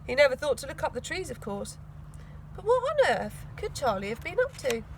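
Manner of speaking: ordinary delivery, nothing marked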